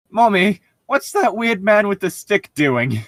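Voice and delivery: put-on high, loud little kid calling to mom; child voice. A child points out the wizard to mommy, high and loud. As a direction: play a startled little kid.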